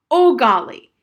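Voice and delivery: loud, high, furious outburst. A narrator's reading. Harriet at the end of her patience with Old Golly, furious. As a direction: shout the name in sheer exasperation. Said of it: "She was really furious".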